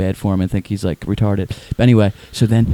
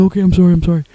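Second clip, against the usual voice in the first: high apologetic mimicry; mock meek, pitched-up sorry; Tim impression; 8 semitones higher, faster than usual. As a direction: imitate his hurried apology in a high voice. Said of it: "he talks like this".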